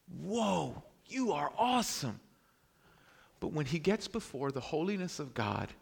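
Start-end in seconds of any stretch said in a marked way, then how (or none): 0.1-2.2 raised, drawn-out amazed exclamation; acted awe. Preacher imagines a man meeting the Lord, acted awe. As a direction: marvel like an impressed onlooker. Imagined awestruck response to God.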